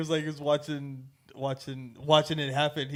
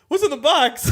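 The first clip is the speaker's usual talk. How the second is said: higher, louder panicked question; panicked yell; 8 semitones higher, 6 dB louder than usual. Retold or imitated. Voice actor sees the casket and yells in panic. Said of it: "He's like".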